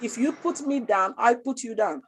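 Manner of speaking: ordinary talk, nothing marked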